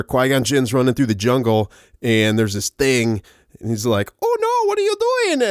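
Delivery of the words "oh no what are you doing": high-pitched squealing cartoon voice; hammed-up high cry of alarm; mock panicked creature. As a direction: yelp it way up high like a goofy creature. Voicing panicking Jar Jar in retelling.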